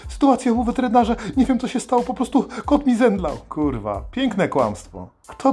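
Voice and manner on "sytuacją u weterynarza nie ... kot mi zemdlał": high, panicky pet-owner voice on the phone; mock frightened. Shown as a demonstration. Caller fakes panic to the boss about a fainted cat, high and frightened. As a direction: sound scared, pitched way up. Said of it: "Przestrasz się trochę".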